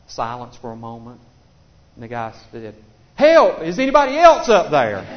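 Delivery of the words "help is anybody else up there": high, loud panicked yell; punchline yell. Retold or imitated. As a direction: yell out for someone else, panicked. Punchline, hiker yells again.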